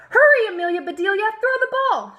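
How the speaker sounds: high, loud and fast cry to hurry; urgent shout. A narrator's reading. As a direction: shout urgently across the field. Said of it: "shouted the boys".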